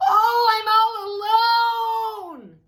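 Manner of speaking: long, high, loud sung-out wail; screaming in fright. A narrator's reading. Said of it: "screamed Toad".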